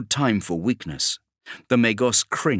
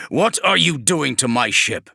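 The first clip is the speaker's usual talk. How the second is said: higher and louder barked battlefield orders; commanding warsmith; 5 semitones higher, 4 dB louder than usual. Acted. Kravarek orders his men to cover the demigod, loud and commanding. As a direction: bark it across the hold to your squads.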